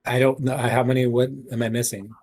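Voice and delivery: normal talking voice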